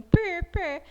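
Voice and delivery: PÊ with a closed E instead of open pé; wrong closed vowel. Shown as a demonstration. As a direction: say pê with the mouth half shut. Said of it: "Não, eu tenho que falar".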